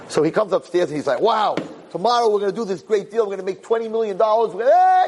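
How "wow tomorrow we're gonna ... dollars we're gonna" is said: rising higher and higher with excitement; all excited. Retold or imitated. Husband bursting about tomorrow's real estate deal, excited. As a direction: gush like a man sure of a big win. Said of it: "he's all excited".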